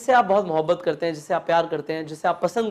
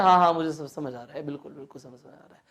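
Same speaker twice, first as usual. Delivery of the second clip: quick, fading yes-yes; hasty fake agreement; 5 semitones lower, 4 dB quieter, faster than usual. Shown as a demonstration. Teacher mimicking a dismissive listener, hurried. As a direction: brush it off, rushing and trailing away. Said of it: "पर लोग क्या करते हैं?".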